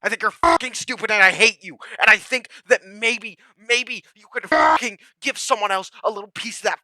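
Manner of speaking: high, strained, shouted insults; screaming rage. Real, not acted. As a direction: scream it until the voice cracks.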